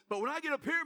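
ordinary talk

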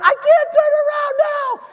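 high, loud exasperated mock shout; frustrated driver. Pastor acts the driver shouting back at the navigation, hammed-up. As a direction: throw up your hands at the sat nav. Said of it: "You're like".

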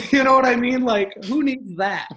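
laughing, slowing down; amused laugh through the words. Real, not acted. Laughing at own dodge.

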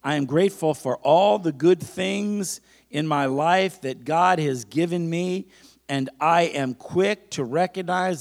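slow, loud preacher's affirmation; emphatic, stretched-out call for repetition; leading a declaration. Acted. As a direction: proclaim it phrase by phrase for the congregation to echo. Leads a congregation in declarations.

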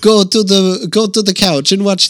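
put-on wobbly Italian-tinged accent; French turning Italian. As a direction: start French, slide into Italian. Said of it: "It's going back to Italian".

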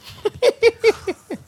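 amused high cackle; high laugh. Real, not acted. Preacher laughs at own joke.

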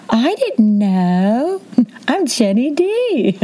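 high, slow, stretched-out mock innocence; playing innocent. Driver who cut the line, hammed-up innocent act. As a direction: plead cluelessness in a coy singsong. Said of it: "might have played the old".

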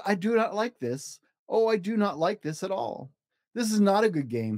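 a hint of a scolding fish; fussy fish. Fish complains.

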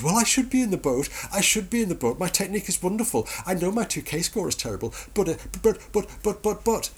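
high whining mock voice, stammering at the end; whiny Frodo. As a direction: whine it like a spoiled hobbit.

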